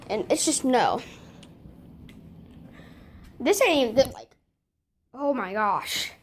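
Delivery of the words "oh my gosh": ordinary talk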